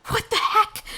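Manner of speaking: startled shout. Acted. Girl waking in water.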